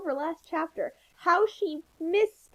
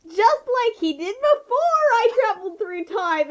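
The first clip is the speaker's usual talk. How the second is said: 1 mock dramatic; 8 semitones higher, 8 dB louder than usual. Sarcastic mock exclamation.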